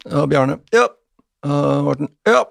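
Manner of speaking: high, sing-out register call with snappy replies; high-pitched classroom roll call; teacher's roll call. Acted. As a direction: call out names like morning register. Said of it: "så er det læreren roper sånn".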